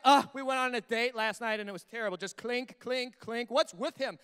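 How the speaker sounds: loud opening groan, then disgruntled recounting; hammed-up complaint about a date; griping dater. Wife griping about last night's terrible date, exasperated.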